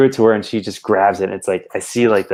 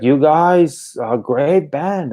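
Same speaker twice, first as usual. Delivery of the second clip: put-on high, slow slurring drunk fan; 7 semitones higher, 3 dB louder, slower than usual. Drunk fan slurring praise at the merch table. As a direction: ham up a hammered fan gushing.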